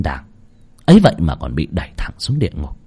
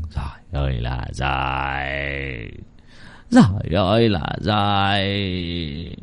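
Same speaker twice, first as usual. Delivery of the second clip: slow, soft, low wail of despair; drawn-out lament; 7 semitones lower, 9 dB quieter, much slower than usual. Acted. Drunk man laments his ruin. (than thở kéo dài, trầm và nhỏ)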